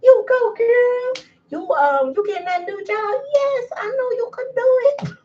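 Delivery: high-pitched, over-the-top hype cheer; cheering squeal. Retold or imitated. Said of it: "I'm just like".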